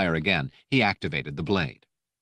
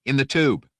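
a raised call, pointing something out; sharp alert shout; 7 semitones higher, 5 dB louder than usual. A narrator's reading. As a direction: call out to the other troopers. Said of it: "he shouted".

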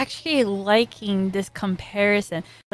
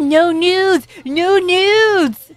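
hammed-up louder shout; mock yell; 7 semitones higher, 7 dB louder, much slower than usual. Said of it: "He said".

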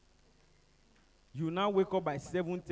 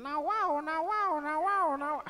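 higher, louder dying siren whine; grumbling siren; 10 semitones higher, 4 dB louder, slower than usual. Retold or imitated. Siren losing power in traffic, whining imitation. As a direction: make the siren groan. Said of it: "the siren started complaining".

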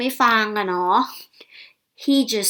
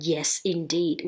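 lower, softer reply in a man's role; lightly voiced Will; 5 semitones lower, 6 dB quieter, much slower than usual. Dog owner answering the vet's praise, lightly acted, low and soft. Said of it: "and said".

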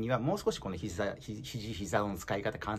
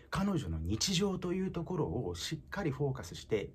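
normal talking voice

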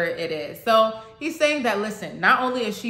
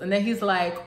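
usual speaking voice

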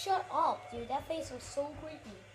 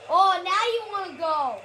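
loud, high trash talk; taunting challenge; 5 semitones higher, 12 dB louder than usual. Real, not acted. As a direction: goad your rival into a fight. Squaring up for a game fight.

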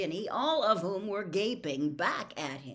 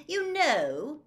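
high, loud, pointed opening; 10 semitones higher, 7 dB louder than usual. A narrator's reading. Godfather speaking to the ceiling, loudly. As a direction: announce it to the whole room. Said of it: "said Sirius loudly".

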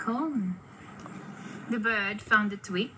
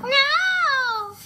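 very high, loud drawn-out cry; shrieked storybook no; witch crying out; 8 dB louder than usual. A narrator's reading. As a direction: wail it as a terrified witch. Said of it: "cried the witch".

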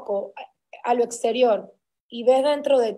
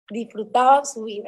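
in air quotes. Real, not acted. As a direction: draw it out as if in quotation marks. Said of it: "lo voy a poner entre comillas".

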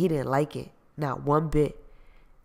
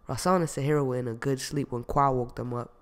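ordinary delivery

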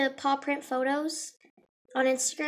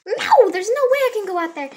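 louder, higher mock protest; retold panicked refusal; 5 semitones higher, 7 dB louder than usual. Vlogger refusing her sister, mock panicked protest. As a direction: protest in exaggerated alarm.

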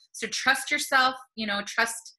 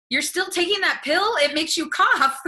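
higher, louder retold disbelief; mock incredulity; 7 semitones higher, 6 dB louder than usual. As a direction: act out baffled disbelief, pitched up. Retelling her disbelief at woman's answer.

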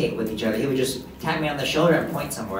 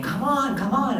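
siblings calling; 11 semitones higher than usual. Retold or imitated. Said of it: "they were saying".

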